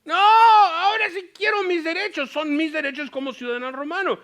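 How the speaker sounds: high, loud shout, slowed on the second no; Paul demanding rights. Retold or imitated. Paul finally insists on his rights to the jailer, shouting. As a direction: shout to claim your rights. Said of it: "ahora él dice".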